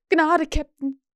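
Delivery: high, loud, trembling begging; whining plea. A narrator's reading. Cornered pirate begs his captain, whining. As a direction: whimper for mercy. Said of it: "winselte Starkey und zitterte".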